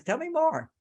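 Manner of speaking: coaxing her to keep talking, eager; little bit of eagerness. Shown as a demonstration. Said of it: "Like a little bit of eagerness".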